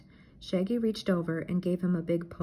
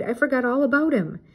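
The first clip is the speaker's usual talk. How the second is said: loud, higher panicked cry; suddenly remember, in a panic; alarmed cry; 6 semitones higher, 7 dB louder than usual. A narrator's reading. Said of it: "Shaggy cried".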